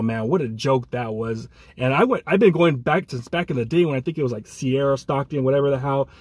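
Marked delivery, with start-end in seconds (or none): none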